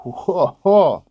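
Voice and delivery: high-pitched whoa of reaction. Real, not acted. Drinker reacts after tasting the strong beer, high whoa. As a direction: let out a real, high whoa.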